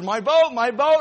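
high, loud cry of delight; overjoyed boy. Acted. Boy spotting his lost boat in the window, overjoyed. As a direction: shout it like finding treasure.

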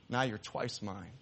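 soft, slow, tender words; tender whisper to boat. Acted. Boy hugging his boat after buying it back, tender. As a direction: speak it lovingly to what you hold.

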